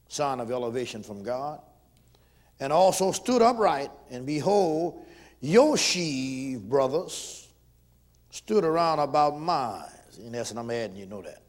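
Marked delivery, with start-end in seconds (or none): 2.6-9.8 loud, slow scripture preaching. Acted. Preacher proclaiming Joseph's dream, loud and slow.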